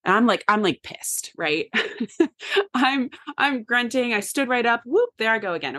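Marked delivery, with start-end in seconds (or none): 1.7-2.7 a quick high burst of laughter; short laugh. Real, not acted. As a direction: laugh it off, amused at yourself. Laughs at her own anger.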